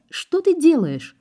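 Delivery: very high, drawn-out horrified question; acted panic, pitch soaring; alarmed cry. A narrator's reading. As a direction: cry out to stop him. Heroine watches him smash the car.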